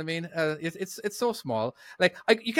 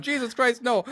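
higher, panicked mock mother's cry; mom screaming; 10 semitones higher than usual. Mom in a panic at her son, a high mock cry. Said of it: "my mom screamed at me".

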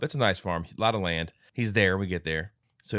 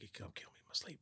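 hushed paranoid whisper; scared whisper alone in bed; fearful whisper; 23 dB quieter, faster than usual. Retold or imitated. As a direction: whisper it like someone's listening. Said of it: "thinking about".

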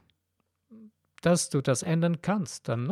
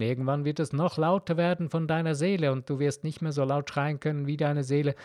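ordinary talk, nothing marked

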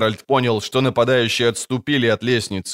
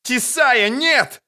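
desperate cry; 13 semitones higher, much slower than usual. Acted. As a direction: cry out in despair to stop her. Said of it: "отчаянно крикнула Трис".

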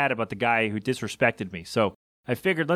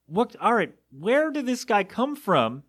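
disbelief; 7 semitones higher than usual. Real, not acted. Disbelief at customer.

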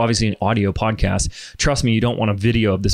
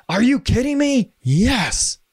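excited; 11 semitones higher, slower than usual. Retold or imitated. Clients answer eagerly on call.

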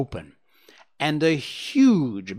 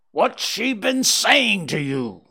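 slow, higher, louder furious demand; furious scream; 8 semitones higher, 5 dB louder than usual. A narrator's reading. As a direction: scream it at Holmes in rage. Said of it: "screamed the old man furiously".

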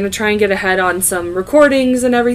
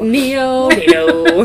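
laughing nickname; 5 semitones higher, 5 dB louder, much slower than usual. Pretend.

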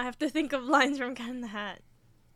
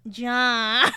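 Garfield's John; 9 dB louder than usual. Retold or imitated. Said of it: "You know what line I know from Garfield".

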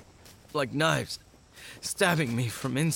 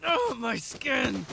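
a slow, drawn-out cry of burning pain; pained slow gasp of a wounded man; 11 semitones higher, much slower than usual. Acted. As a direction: stretch it out as the skin burns. Burning pain from haptic discharge.

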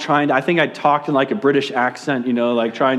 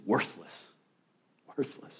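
low, hushed condemnation; very soft, low and damning; accusing inner voice; 5 semitones lower, 15 dB quieter than usual. Acted. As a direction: drop right down, like a thought in the head.

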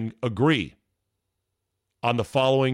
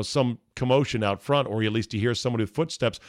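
ordinary delivery

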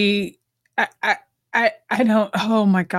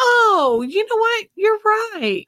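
high, breathless mock gushing; 11 semitones higher than usual. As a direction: gush like a lovestruck fan. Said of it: "what did he expect her to go".

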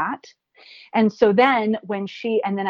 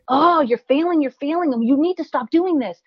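high, loud scolding; harsh inner critic; 8 semitones higher, 3 dB louder than usual. Retold or imitated. Guest imitating the harsh voice people turn on themselves, raised and high. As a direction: berate yourself mockingly. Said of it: "often we get like".

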